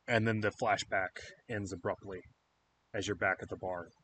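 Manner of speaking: ordinary delivery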